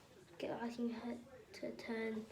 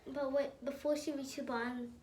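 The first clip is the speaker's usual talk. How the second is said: usual speaking voice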